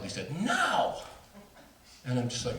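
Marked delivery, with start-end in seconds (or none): 0.4-1.0 high-pitched mock yell; sudden high cry, half-voiced yell; retold yell. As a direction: imitate a yelled refusal without full volume. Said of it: "he just yelled".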